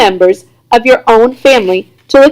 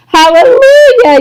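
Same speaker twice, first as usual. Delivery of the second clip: yelled hallelujah; 13 semitones higher, 4 dB louder than usual. A narrator's reading. Grandfather celebrating the trip, loud high jubilant yell. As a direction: belt out pure joy. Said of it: "yelled Grandpa Joe".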